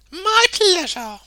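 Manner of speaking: high, bright friendly reply; a kind woman answering gladly; cheerful woman's voice. A narrator's reading. The woman replies.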